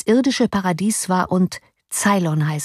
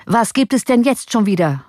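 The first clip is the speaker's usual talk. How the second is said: higher, exasperated question; mildly angry; 5 semitones higher, 3 dB louder than usual. A narrator's reading. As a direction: ask with a touch of irate impatience. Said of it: "lief vor Zorn rot an".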